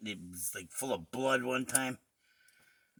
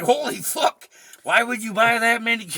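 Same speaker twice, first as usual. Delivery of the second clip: mock disbelief; 7 semitones higher, 8 dB louder than usual. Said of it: "I'm like".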